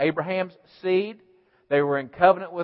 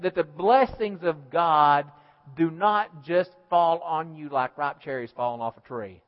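ordinary talk, nothing marked

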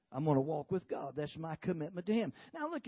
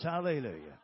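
laughing hallelujah; 6 semitones lower, 4 dB louder than usual. Real, not acted. Preacher exults retelling victory.

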